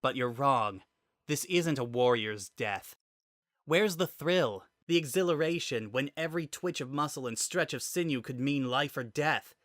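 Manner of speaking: a pinned fighter railing at her captor; rising to a heated, defiant peak; fierce warrior heroine. Acted. Samus arguing passionately.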